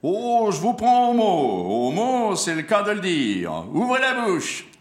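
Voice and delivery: high, loud, pompous circus ringmaster; huffy showman taking up the dare; indignant ringmaster. Acted. Monsieur Cordial challenging the clown.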